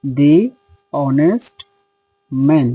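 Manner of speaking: "দি" as dee, then "অনেস্ট" with silent h; spaced-out "দি অনেস্ট ম্যান", word by word; dee before vowel. Shown as a demonstration. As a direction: say "অনেস্ট" from an o sound after a dee "দি". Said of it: "সাউন্ডটা হচ্ছে অ sound".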